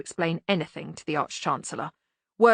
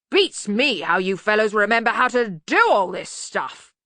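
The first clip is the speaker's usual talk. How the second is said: loud, hearty wonderment; blustering Arch-Chancellor; 3 semitones higher, 6 dB louder than usual. Acted. Arch-Chancellor watching lads work the machine, hearty bluster. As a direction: marvel with bluff volume. Ridcully character dialogue.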